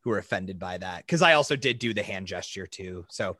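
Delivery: low, hushed apology; mock-solemn apology. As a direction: drop right down into a grave, soft apology. Mock-formal apology for the accent.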